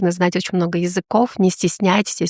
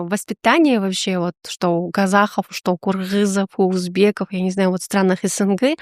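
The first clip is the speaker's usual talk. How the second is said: usual speaking voice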